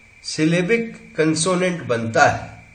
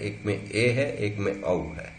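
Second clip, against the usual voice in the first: ordinary talk, nothing marked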